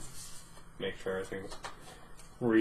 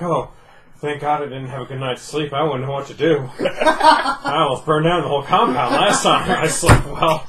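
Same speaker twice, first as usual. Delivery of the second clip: mock relieved voice; 4 semitones higher, 9 dB louder than usual. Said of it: "I wake up and I'm like".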